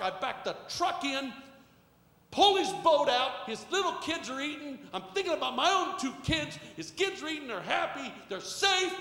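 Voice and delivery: loud, high, impassioned pulpit delivery; full-force preaching. Real, not acted. Preacher telling how God showed up in life, impassioned. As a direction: drive each phrase home to the congregation.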